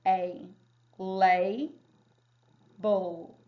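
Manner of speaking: "A, lay, bull" said slowly, one syllable at a time; syllables sounded out. Shown as a demonstration. Teacher sounding out label by its syllables. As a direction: sound out each syllable with a long A. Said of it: "This one would say".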